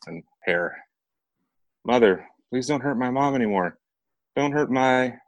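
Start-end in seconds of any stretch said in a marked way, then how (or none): 1.8-5.2 high, pleading cry for her mother; desperate plea. A narrator's reading. Bound girl begs the men to spare her mother, high desperate plea. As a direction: beg them to stop, voice straining.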